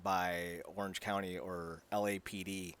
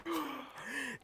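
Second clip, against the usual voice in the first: mock gasp; 8 dB quieter than usual. Dramatic reveal of parentage.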